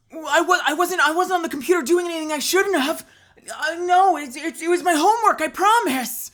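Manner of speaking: put-on high, loud defensive teen boy; a guilty teen boy; hollow teen boy voice. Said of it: "that voice works so much better".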